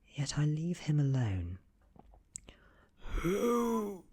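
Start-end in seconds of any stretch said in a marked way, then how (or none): 3.0-4.0 high, drawn-out gasp; breathy high gasp of a wounded man; gasped question. A narrator's reading. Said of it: "he gasps at me".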